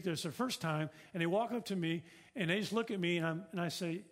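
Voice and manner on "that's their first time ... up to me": ordinary delivery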